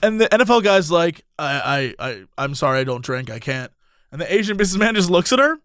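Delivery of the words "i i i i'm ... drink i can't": mildly sheepish, low and soft retold refusal; hesitant apology. NFL guy turning down a drink, hesitant apology. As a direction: imitate a reluctant man, low and halting.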